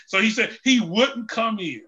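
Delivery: ordinary delivery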